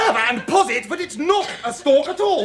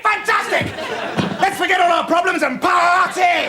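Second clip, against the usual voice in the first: high, whooping delight; exuberant shout; 5 semitones higher than usual. Acted. Richie hearing girls are coming round, exuberant shout. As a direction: cheer it out, ready to celebrate. Cheering at news of a party.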